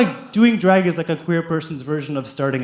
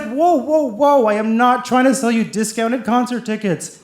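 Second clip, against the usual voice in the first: defensive protest; 6 semitones higher than usual. Acted. Acted defensive protest in quarrel.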